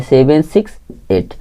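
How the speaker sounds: Seven six eight said slowly as three digits; digit-by-digit reading. Shown as a demonstration. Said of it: "एक एक अंक को पढ़ते हैं". (एक-एक अंक धीरे से पढ़ते हुए)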